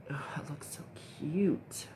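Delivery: hushed, delighted whisper; slow admiring whisper. Real, not acted. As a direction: whisper it, charmed by the look.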